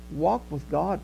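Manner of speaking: normal talking voice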